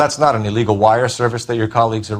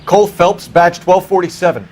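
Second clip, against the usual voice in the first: radio call; 6 semitones higher, 5 dB louder than usual. Acted. Detective identifies himself over radio.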